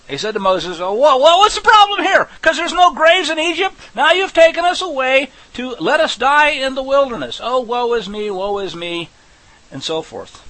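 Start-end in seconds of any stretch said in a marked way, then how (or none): 0.8-9.1 high, loud, complaining whine; whining Israelites. Acted. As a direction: whine like a crowd of ungrateful ex-slaves. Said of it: "they begin to whine".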